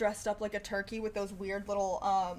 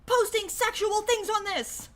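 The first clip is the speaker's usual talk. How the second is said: much higher and louder, slowed, a hammed-up complaint; mock outraged; 9 semitones higher, 6 dB louder than usual. Telling off imagined commenters, hammed-up outrage. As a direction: play the furious activist scolding the comments. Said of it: "I'm like".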